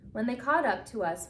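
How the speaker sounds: plain reading voice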